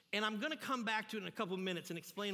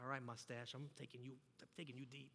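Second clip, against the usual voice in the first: low, very soft tough talk; muttered challenge; 7 semitones lower, 13 dB quieter than usual. Retold or imitated. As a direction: size up the pitcher under your breath. Acted muttered challenge to the pitcher.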